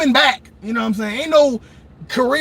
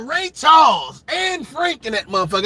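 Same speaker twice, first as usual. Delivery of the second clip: usual speaking voice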